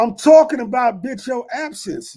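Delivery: ordinary delivery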